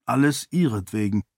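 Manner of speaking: plain reading voice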